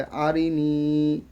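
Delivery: আরিনি with short আরি and long held নি; stretched last syllable. Shown as a demonstration. Teacher contrasting voweled and madd letters, long final নি. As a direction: keep আরি quick, draw out the i of নি. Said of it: "এটাকে টান দিয়ে পড়লাম".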